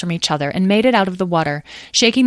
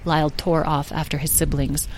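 plain reading voice

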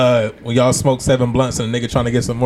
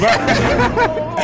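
laughing. Pretend. Repeated catchphrase, slow high laughing.

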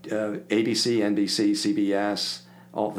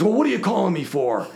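louder, higher, impatient and annoyed; annoyed gruff caller; 10 semitones higher, 6 dB louder than usual. Retold or imitated. Record man annoyed at a stranger's call, mock gruff. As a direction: bark it like a grumpy stranger on the phone.

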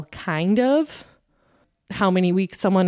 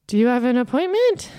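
surprised screener; 7 semitones higher, 5 dB louder than usual. Retold or imitated. Screener stops her at door.

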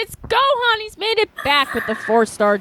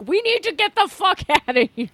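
panicked friends; 7 semitones higher, faster than usual. Acted. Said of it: "they're like".

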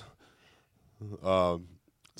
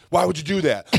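higher-pitched mock scolding; yelling angry dad; 9 semitones higher, 4 dB louder, faster than usual. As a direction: imitate a fuming dad's demand. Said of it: "he's yelling at me".

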